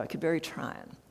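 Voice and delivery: a gentle Southern lady saying very trying; soft Southern accent. Retold or imitated. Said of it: "very soft Southern accent".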